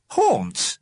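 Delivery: high, questioning echo; startled echo. A narrator's reading. William Stickers queries the alderman's word, startled. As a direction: throw the word back, pitched right up.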